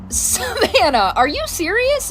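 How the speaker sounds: drawn out slowly, pitched high in disbelief; slow, high, can't believe it; incredulous. Retold or imitated. Mom realising her daughter faked being sick, incredulous.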